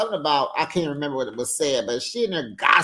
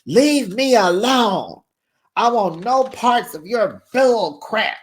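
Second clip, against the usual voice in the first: higher, loud, slow furious line; angry yell; 6 semitones higher, 6 dB louder than usual. Retold or imitated. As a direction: shout her off, fed up. Jen yelling at Tamara.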